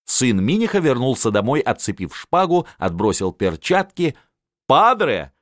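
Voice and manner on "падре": high, louder joyful call; happy shout. A narrator's reading. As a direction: cry out with delight. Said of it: "закричал он счастливый". (радостный выкрик)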